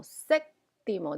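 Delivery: séptimo said slowly, SÉP- stressed clearly; teacher sounds out séptimo slowly, hitting the first syllable; stress on the accent. Shown as a demonstration. Teacher showing students the accent, slow clear séptimo. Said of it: "because the accent".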